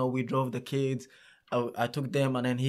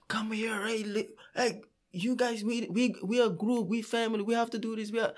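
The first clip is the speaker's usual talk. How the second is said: retold scream; 9 semitones higher, 3 dB quieter than usual. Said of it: "I had to scream".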